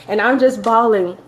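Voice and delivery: ordinary delivery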